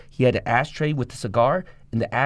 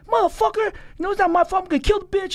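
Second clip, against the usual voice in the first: high, raised mock yell of angry inmates. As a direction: throw your voice up high like a furious inmate across the wing. Inmates yelling across the wing.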